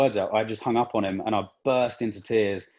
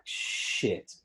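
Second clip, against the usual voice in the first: breathy whispered swear, barely there; hushed, stunned whisper; whispered curse; 6 dB quieter than usual. Retold or imitated. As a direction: whisper it like the floor dropped away. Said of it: "And I was like".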